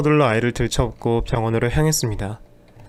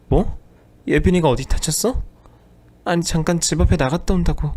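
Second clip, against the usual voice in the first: higher, startled and fumbling; flustered wife; 5 semitones higher than usual. Acted. Wife caught lying, startled and babbling excuses. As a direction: scramble for an excuse.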